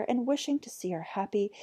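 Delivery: plain reading voice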